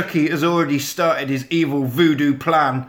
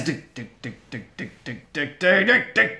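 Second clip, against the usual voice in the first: imitated climbing noises. Miming Chucky coming upstairs, ticking build-up.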